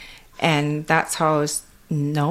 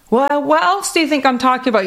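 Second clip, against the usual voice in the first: granny's exasperated retort; 9 semitones higher, 5 dB louder, faster than usual. Retold or imitated. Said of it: "she's like".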